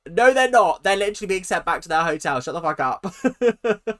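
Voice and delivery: a loud, laughing told-you-so comeback; exasperated retort. Retold or imitated. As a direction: brush off their panic, loud and laughing. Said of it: "And I'd be like".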